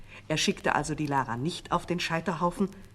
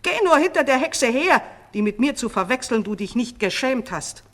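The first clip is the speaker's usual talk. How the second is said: high, much louder contempt; scornful outburst; 7 semitones higher, 10 dB louder than usual. A narrator's reading. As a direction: drive him off with scorn. False queen scornfully dismisses vizier.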